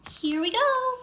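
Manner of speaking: high, loud excited cry; thrilled as the wind spins them; cried out. A narrator's reading.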